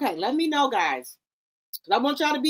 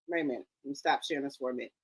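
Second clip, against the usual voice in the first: quiet aside; 11 semitones lower, 10 dB quieter, much faster than usual. Real, not acted. Aside while stopping screen share.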